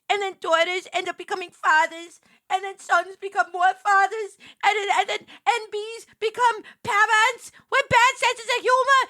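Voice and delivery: high, loud theatrical rant; rally the crowd against dad jokes; hammed-up outraged speech; mock outrage rant.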